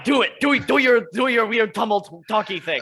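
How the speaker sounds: high, loud exasperated mockery. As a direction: wave him off, fed up. Character snaps at cryptic companion.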